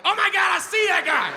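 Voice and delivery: high, loud excited yell; excited shout. Retold or imitated. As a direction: shout it like you spotted a celebrity. Excited shout at the bush man.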